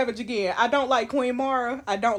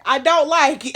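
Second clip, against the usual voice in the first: loud, higher, flat-out declaration; firm dislike; 4 semitones higher, 9 dB louder than usual. Real, not acted. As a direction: plant your foot: you don't like it. Gamer repeats her dislike.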